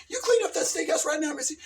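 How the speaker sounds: high, hammed-up Southern scold; mock Southern mama; Southern scolding mom. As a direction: boss her around like a Southern mother. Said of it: "She's from the South".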